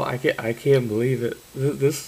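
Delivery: ordinary talk, nothing marked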